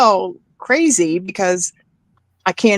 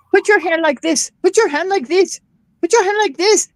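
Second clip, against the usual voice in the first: bossy, higher mock voice of impatient salon staff, getting more insistent; mean nail technician; 11 semitones higher than usual. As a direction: mimic curt orders, rising higher each time. Mimicking pushy nail salon workers.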